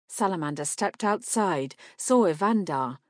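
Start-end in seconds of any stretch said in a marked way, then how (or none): none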